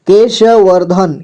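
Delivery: केशवर्धन slowly, with श given its own vowel; syllable by syllable. Shown as a demonstration. Teacher showing how केशवर्धन is read, slowly syllable by syllable. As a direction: sound out केशवर्धन slowly, piece by piece. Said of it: "केशवर्धन ऐसे".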